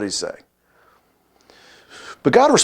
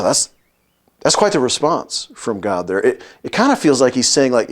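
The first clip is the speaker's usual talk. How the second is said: ordinary delivery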